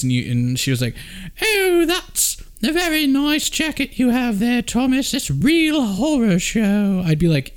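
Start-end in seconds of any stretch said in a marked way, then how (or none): 1.4-7.0 much higher, slow, posh British queen voice; Queen impression. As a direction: play the Queen, gracious and slow. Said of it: "Like the Queen would say that".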